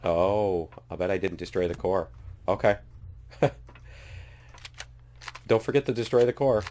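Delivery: usual speaking voice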